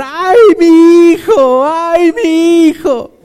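milk a fake sob over the child; mock wailing. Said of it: "O no empezó a llorar".

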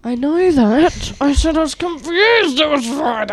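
exasperated. Real, not acted. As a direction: draw it out, exasperated at being corrected. Exasperated reply to classmates correcting her.

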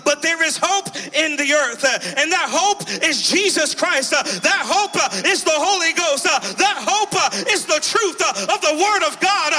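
rising, fervent revival preacher delivery; full-force preaching. Real, not acted. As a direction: build steadily to a high, driving shout.

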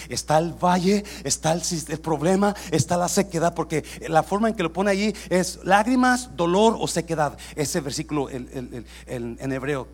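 shouted, high, fervent sermon cry; full-force preaching. Acted. Pastor shouting blessing over the congregation, full-force preaching. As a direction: preach it out to the whole church.

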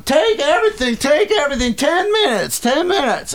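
woman yelling about the ten minute giveaway; imitated woman yelling. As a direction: shriek the offer frantically. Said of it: "the woman's going".

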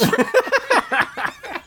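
high-pitched burst of laughter; laughing. Real, not acted. Teller laughing after the Willie quote, amused. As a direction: crack up helplessly.

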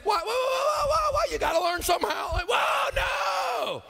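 high-pitched, shouted pleading; mock protest. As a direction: protest loudly at someone walking out. Acts surgeon protesting as patient leaves.

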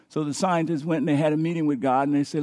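ordinary delivery

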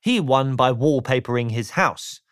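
usual speaking voice

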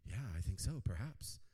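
mock heavenly voice; voice of the Lord. Voicing the Lord's answer.

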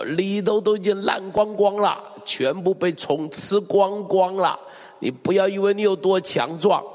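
rising to a shouted climax of condemnation; fervent preaching. Acted. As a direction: push it out like a fiery preacher. Preaching at full force.

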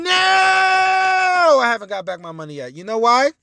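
fed-up shout at the insurance mess; exasperated outburst. Real, not acted. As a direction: vent the frustration in bursts. Angry rant about car.

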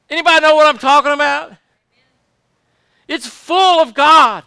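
real exultant preacher's shout; loud, overflowing. Real, not acted. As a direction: call it out to the whole congregation. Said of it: "It's hard to be quiet".

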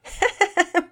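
high, loud quick laugh; acted high-pitched laughter; mom's laugh. A narrator's reading. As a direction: burst into a high giggle as Mom. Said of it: "Mama Peters laughed".

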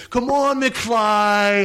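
high-pitched mock bully heckle; Biff hassling. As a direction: hassle the comic like a movie bully. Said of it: "Biff is hassling".

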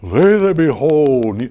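eloquent preacher voice. Retold or imitated. Preacher mocking a radio preacher's slow grand delivery. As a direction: draw it out like an overblown orator, lightly. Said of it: "he's preaching, very eloquent voice".

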